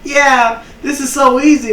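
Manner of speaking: high-pitched, breezy overconfidence, imitated; mock optimism. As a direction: imitate cocky rookies with the voice way up. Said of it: "they were real optimistic".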